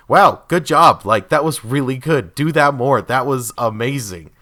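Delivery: loud, hearty cheering; praising a good scare loudly; enthusiastic praise. Retold or imitated. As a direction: applaud the scare actor. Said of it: "I will always tell them, like".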